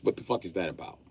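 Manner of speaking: usual speaking voice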